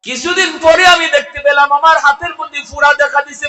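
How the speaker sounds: high, shouted sermon chant; full-force preaching. A narrator's reading. Son telling the cleric how his hand began rotting, loud high chant. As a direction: belt out the story on sustained high notes.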